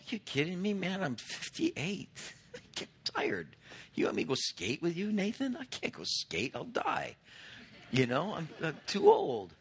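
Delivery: hammed-up tired old man, high squeaky bits with a laugh; mock feeble complaint to his son; whiny self-parody. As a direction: whine like you're too old to skate. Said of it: "I can sit at home and go".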